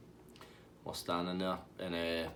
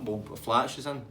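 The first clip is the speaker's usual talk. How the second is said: usual speaking voice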